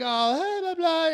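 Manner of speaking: dismissive imitation of chatter; mock chatter. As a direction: parody their talk, climbing in pitch. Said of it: "and they're like".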